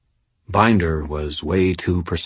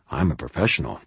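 muttering; 3 semitones lower, 6 dB quieter, much faster than usual. A narrator's reading. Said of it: "I muttered".

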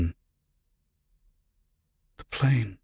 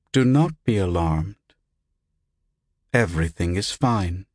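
lightly voiced recorded announcement; hollow recorded voice. A narrator's reading. Unseen voice to waking patient, hollow and recorded. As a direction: reassure in a measured, impersonal voice. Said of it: "The voice sounded hollow, recorded".

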